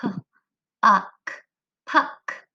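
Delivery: "P-" then "uck", blended to "puck"; sounding out. Shown as a demonstration. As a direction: split the word, then join it. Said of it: "The next word".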